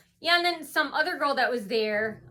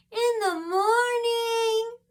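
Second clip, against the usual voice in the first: slow, much higher, drawn-out sneer; mock rude dentist; 12 semitones higher, 5 dB louder, much slower than usual. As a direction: drag it out high and snide. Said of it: "said it in that tone".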